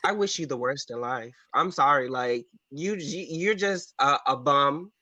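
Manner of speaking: usual speaking voice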